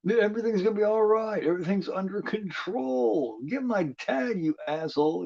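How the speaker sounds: imitating a panicked girl; frantic girl. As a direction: plead like a scared teenager.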